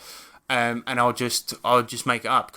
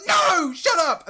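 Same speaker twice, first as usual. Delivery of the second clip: much higher, slow, louder shriek; annoyed child shouting; 4 dB louder, much slower than usual. Retold or imitated. Uncle imitating his annoyed niece shouting at him, high and loud. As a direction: yell it like an enraged seven-year-old. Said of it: "she got really annoyed, and she was like".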